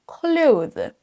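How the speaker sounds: CLOTHES slow, with TH and Z; prononciation complète. Shown as a demonstration. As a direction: sound out the TH-Z end of CLOTHES. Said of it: "le prononcent de cette façon". (CLOTHES avec TH et Z articulés)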